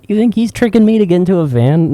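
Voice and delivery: hammed-up childish protest; imitated incredulous child. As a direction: argue like a doubting kid. Child self incredulous at mom.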